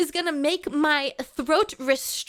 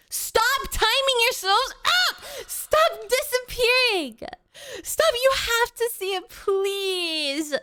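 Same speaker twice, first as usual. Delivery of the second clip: much higher, slow, desperate yelling; pleading shout; 7 semitones higher, slower than usual. Real, not acted. Begging chat to stay and see the TikTok, pleading shout. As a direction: call them back before they leave.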